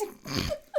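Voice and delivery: high, breath-only burst of laughter; real sudden giggle; helpless laugh. Real, not acted. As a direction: crack up mid-reading. Cracking up at the read line.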